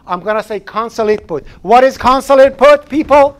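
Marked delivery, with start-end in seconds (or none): 1.6-3.4 loud rhetorical question to the room; calling out to class. Real, not acted. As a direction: grab the class's attention.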